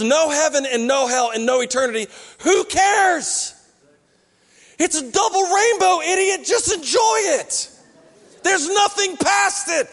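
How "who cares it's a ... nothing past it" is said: exasperated shout. Acted. Preacher yells back at the rainbow man, mock exasperated. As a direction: shout it like he's being an idiot.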